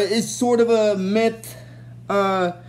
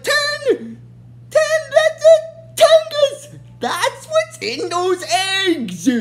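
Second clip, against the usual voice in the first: cartoon henchman shrieking with glee; excited realization; 13 semitones higher, much slower than usual. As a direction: squeal it out as it suddenly clicks. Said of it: "He's like".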